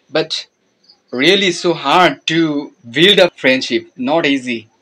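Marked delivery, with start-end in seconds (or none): none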